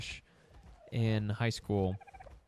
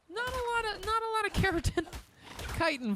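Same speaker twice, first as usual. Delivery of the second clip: squeaky mock voice; faster than usual. Mocking squeaky voice at dead dino.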